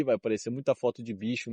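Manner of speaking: ordinary talk, nothing marked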